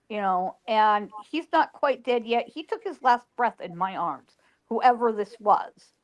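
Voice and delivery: ordinary talk, nothing marked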